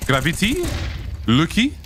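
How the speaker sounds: put-on player names in a French accent; Gravity said the French way, gra-vee-TEE. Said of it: "We have to do French accents every time we say their names".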